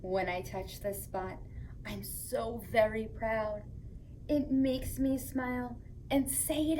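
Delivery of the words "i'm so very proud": plain reading voice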